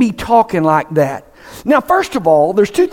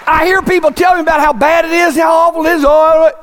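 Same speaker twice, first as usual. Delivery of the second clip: rising preacher's shout; preaching shout; 8 semitones higher than usual. Real, not acted. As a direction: throw it out to the back pews. Preacher erupts after whisper.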